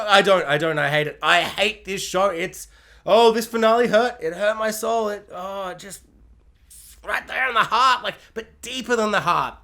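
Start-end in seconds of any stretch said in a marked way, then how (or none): none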